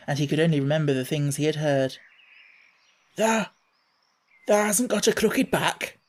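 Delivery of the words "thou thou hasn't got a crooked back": hoarse. Acted. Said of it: "he said hoarsely".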